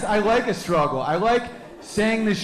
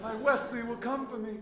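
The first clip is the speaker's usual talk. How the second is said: Buttercup voice; 6 semitones higher, 10 dB quieter than usual. Retold or imitated. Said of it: "that chick, remember, uh, Princess Bride?".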